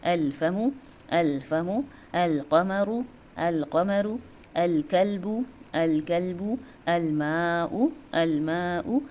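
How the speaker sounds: slow, measured word list, each "अल" crisply sounded; teacher's model reading for learners, l fully audible; clear lam. Shown as a demonstration. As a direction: draw out each word after a distinct "अल", as in "अल माउ". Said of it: "लाम का sound कितना clear है".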